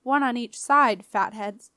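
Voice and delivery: loud, scornful skipper's order; snarling. A narrator's reading. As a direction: snarl it at the dim crew. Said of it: "snarled the skipper".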